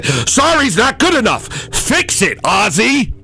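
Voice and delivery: very high-pitched mock outrage; angry boss. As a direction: play a tyrant boss rejecting the apology. Plays angry boss rejecting apology.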